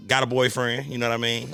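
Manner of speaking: ordinary delivery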